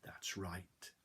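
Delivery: ordinary delivery, nothing marked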